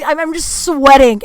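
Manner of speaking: ordinary talk, nothing marked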